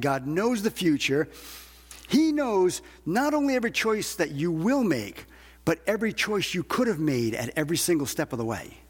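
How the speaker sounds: usual speaking voice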